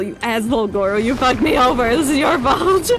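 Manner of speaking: usual speaking voice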